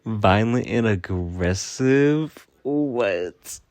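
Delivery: slow, loud disbelief; incredulous. Real, not acted. Throwing a coworker's accusation back, slow and loudly incredulous. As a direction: drag the words out loudly in disbelief.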